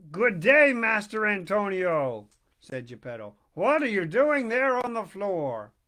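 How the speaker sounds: put-on loud, lively old man calling out; a hearty neighbour bursting in; Geppetto's booming greeting.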